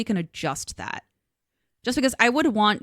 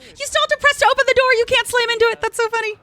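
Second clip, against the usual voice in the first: much higher, louder excited reaction; amused surprise; 8 dB louder, faster than usual. Real, not acted. Streamer reacting to a funny door mechanic, high and excited.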